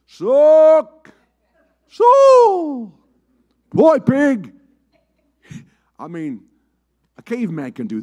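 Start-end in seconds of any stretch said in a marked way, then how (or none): 0.0-4.5 high, loud hollered cow and pig calls; preacher mocking the old farm calls, hollered high and loud; farm animal calls. Shown as a demonstration. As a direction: holler them out, mocking how simple they are. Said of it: "how smart do you have to be to say".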